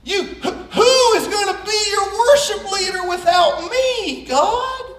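high, whiny protest to God; a pouting fallen angel talking back to God; mock whiny Satan. Satan whining to God about losing his job.

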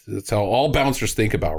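normal talking voice

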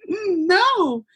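high singsong refusal, copying her eagerness; hum, then refuse in a high, mock-eager tone; mock excited. Me refusing the recruiter in her own tone, mock excited. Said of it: "responded in the same tone of voice".

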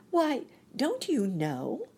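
put-on high, drawn-out girlish disbelief; surprised little girl, voice lifted. A narrator's reading. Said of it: "she returned in surprise".

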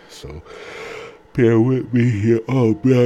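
talking through a yawn. Real, not acted. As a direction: stretch the words in a yawn. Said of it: "yawnage going on".

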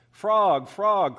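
loud eager call. Acted. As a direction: call out to a friend. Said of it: "cried Toad".

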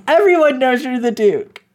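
high, laughing emphasis on the obvious; mock-exasperated retort; amused exasperation. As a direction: hit "Everyone" hard, then let it fall. Acts Chloe stating the obvious, amused.

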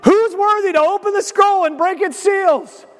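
over-the-top herald cry; hammed-up angel call. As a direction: shout it like an angel hyping the crowd. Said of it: "He's like".

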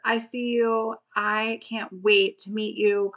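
ordinary delivery

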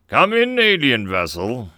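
booming hail over the intercom. A narrator's reading. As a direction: boom it out over the ship's intercom. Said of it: "the voice boomed".

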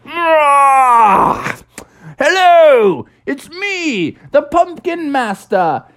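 high, loud grand pumpkin announcing itself. Acted. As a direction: shout hello like a proud new pumpkin. New pumpkin comes to life.

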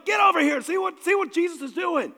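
high, loud shout to the buddies across the water; calling out shout. Acted. Peter calling his fishing buddies over, excited high shout. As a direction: yell for the other boats to come look. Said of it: "he signaled his buddies".

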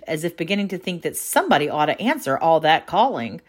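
ordinary delivery, nothing marked

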